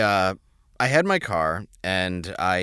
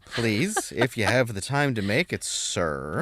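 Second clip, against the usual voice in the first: hammed-up courteous ordering, pitched up; exaggerated politeness; 7 semitones higher, slower than usual. Customer ordering a quesadilla, mock-deferential and careful. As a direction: plead very politely, dropping on the last word.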